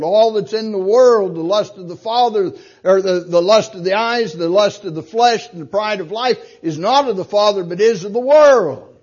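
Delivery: preacher warning the congregation they can lose their soul; preaching at full force. Acted. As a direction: hammer the warning home.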